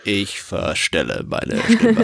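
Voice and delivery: slow disguised zombie voice. Pretend. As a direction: drag the words out like the undead. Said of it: "ich höre mich an wie ein Zombie".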